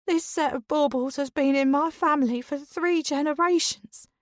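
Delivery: high, weepy, hammed-up grief; theatrical tearful lament; dramatic sobbing. A narrator's reading. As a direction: wail it like a drama queen. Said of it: "Dawn sobs dramatically again".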